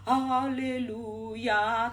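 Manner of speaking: slow, drawn-out hypocritical praise; mock-pious chant of praise; hollow hallelujah. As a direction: stretch each haleluya out, fake pious. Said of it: "bisa gini".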